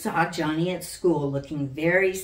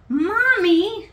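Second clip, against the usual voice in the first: put-on high, loud small-girl voice calling for mommy; a worried little girl calls her mother; little girl crying out; 10 dB louder than usual. A narrator's reading. Little Sally calls Mommy about her wet bed, high and loud. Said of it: "she cried".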